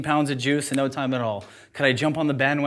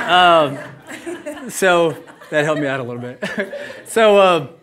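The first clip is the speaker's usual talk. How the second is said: laughing; 4 semitones higher, 7 dB louder than usual. Real, not acted. Recovering laughing after tears.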